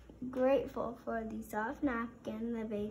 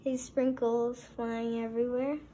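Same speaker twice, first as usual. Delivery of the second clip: plain reading voice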